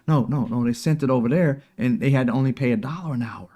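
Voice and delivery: ordinary talk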